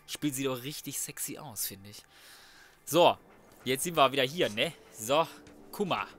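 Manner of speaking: ordinary talk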